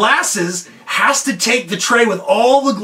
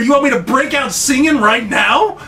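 high-pitched mock disbelief; retold inner outburst of shock; incredulous panic; 5 semitones higher than usual. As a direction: jump up high on the first word, aghast. Panicked thought on being told to sing.

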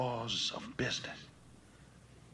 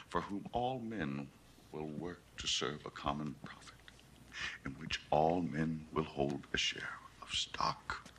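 low voice fading toward a near whisper, long pauses; hushed evangelism; 9 semitones lower, 7 dB quieter than usual. Acted. Selling Beale a perfect world to preach, hushed evangelism. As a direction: intone a soothing vision, ever softer.

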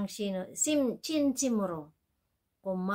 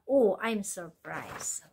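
slow model phrase; much slower than usual. Shown as a demonstration. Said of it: "어머".

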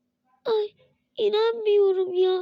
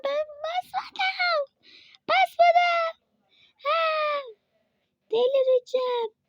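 outraged disbelief; 9 semitones higher, slower than usual. Real, not acted. Gamer protesting to viewers that she never stepped on it. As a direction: shriek that you didn't step there.

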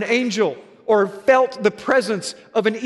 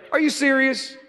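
surprised wife; 6 semitones higher than usual. Retold or imitated. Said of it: "She's like".

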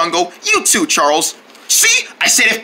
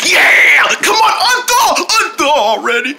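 yelling; 7 semitones higher, 3 dB louder than usual. Acted. Yelling at turkey.

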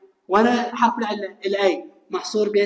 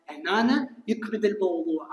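normal talking voice

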